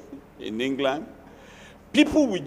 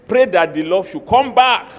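louder, pressing the word pray; louder repeat; 3 semitones higher, 9 dB louder than usual. Shown as a demonstration. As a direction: drive the advice home forcefully. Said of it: "where that love went".

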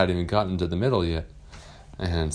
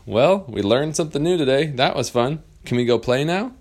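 put-on higher, louder cheerful children; happy kids; 7 semitones higher, 5 dB louder than usual. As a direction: thank the teacher eagerly. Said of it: "They said".

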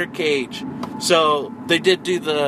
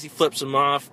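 ordinary talk

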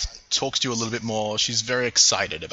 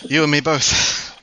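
soldier voice; 5 semitones higher, 5 dB louder, slower than usual. Acted. NPC reply in character.

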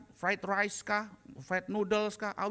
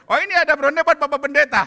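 loud enticing offer; 7 semitones higher, 13 dB louder than usual. Retold or imitated. Woman repeats offer enticingly.

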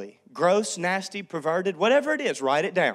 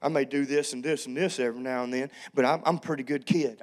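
lightly voiced East Texas teen, lower, fast; a hint of an East Texas kid shrugging it off; 7 semitones lower, faster than usual. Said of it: "That's how they all talked".

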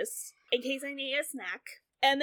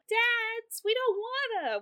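high, whiny kid imitation; whining child; 9 semitones higher, slower than usual. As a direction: complain like a reluctant child, pitched up. Voices kids refusing the visit.

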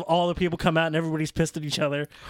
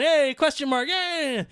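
crowd cheer; 11 semitones higher, slower than usual. Retold or imitated. Voicing the crowd cheering for the masked wrestler, mock whoop. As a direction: whoop it like a fan in the stands. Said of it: "and they're still going".

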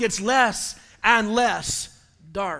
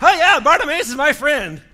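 high, loud bandwagon boasting; hammed-up sudden pal; mock fawning; 5 semitones higher, 7 dB louder than usual. Fickle crowd claiming the beggar as friend, mock fawning.

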